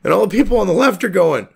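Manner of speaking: usual speaking voice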